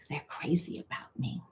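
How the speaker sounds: a gloating private whisper; smug whisper. Acted. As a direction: whisper it to yourself, pleased. Voicing the partner's smug thoughts.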